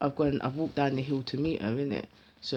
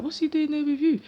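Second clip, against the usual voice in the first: accusing; 10 semitones higher than usual. Retold or imitated. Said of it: "the way he was talking".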